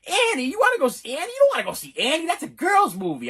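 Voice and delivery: high, loud mock disbelief; incredulous dad. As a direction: object like an appalled father. Acting father objecting to son's choice.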